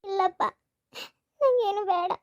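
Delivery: a daughter weeping as she says goodbye; tearful bride. Acted. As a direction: bride crying softly to her father as she leaves. Bride leaving her father.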